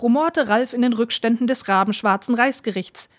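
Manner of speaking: plain reading voice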